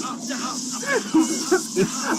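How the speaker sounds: long, high laugh; amused laughter. Real, not acted. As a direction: laugh helplessly at the absurdity. Laughs at feet being eaten.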